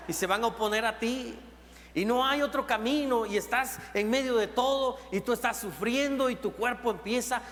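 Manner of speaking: loud, driving pulpit preaching; preaching at full force. Acted. Pastor preaching loudly to his congregation about suffering. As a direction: preach it out to the whole church.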